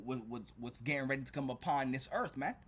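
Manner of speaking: ordinary talk, nothing marked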